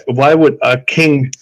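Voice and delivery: usual speaking voice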